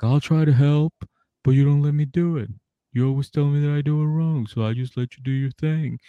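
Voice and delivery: high-pitched mock defensive husband; put-on pleading excuse, pitched high; Sean. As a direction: push the voice up in protest. Acting Sean's defensive retort.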